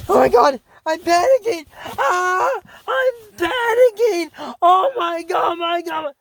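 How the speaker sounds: high, loud, hammed-up panicked wailing; uncontrollable panic. Shown as a demonstration. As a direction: act out a full mock meltdown. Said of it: "right example right there of panic uncontrollably".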